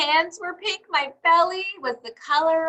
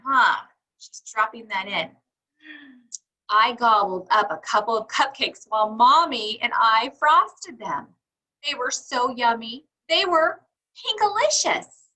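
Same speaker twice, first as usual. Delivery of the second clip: ordinary delivery, nothing marked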